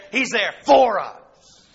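"for us" drawn out slowly and stressed; slow right down and lean on "for us"; emphatic stress. Real, not acted. Contrast with "not just there.

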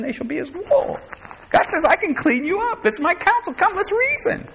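very high, pleading preacher's cry; impassioned preaching. Acted. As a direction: cry it out high as God's appeal. Preacher voices God's plea, building high.

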